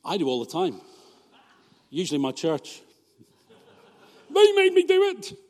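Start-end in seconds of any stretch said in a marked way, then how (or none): 4.3-5.4 high, loud whining excuse; mock whiny excuse. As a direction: whine it like a child caught out. Blaming the church, mock whine.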